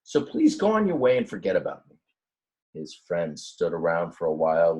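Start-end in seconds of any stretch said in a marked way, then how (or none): 0.0-2.0 pleading ram. Acted. Ram sends friends away.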